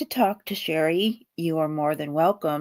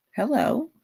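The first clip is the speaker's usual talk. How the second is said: ordinary delivery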